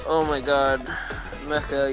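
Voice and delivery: usual speaking voice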